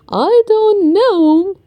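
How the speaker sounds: lightly voiced slow grandpa; Grandpa Pig. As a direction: draw it out as a kindly old pig. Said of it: "says Grandpa Pig".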